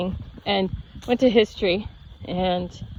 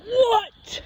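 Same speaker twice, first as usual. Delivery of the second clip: sudden, much higher mock yell; yelled back; 14 semitones higher than usual. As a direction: snap back a startled yell. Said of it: "And I yelled".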